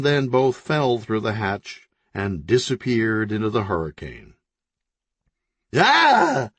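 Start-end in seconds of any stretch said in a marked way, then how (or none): 5.8-6.5 sudden high, loud cry of terror; a short scream of fright; startled shriek; terrified yell. A narrator's reading. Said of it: "Crease yelled in terror".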